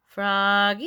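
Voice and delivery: long call swooping up. A narrator's reading. Teacher calling Froggy trudging away, drawn-out rising call. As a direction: holler the name with a rising end. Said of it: "called Miss Witherspoon".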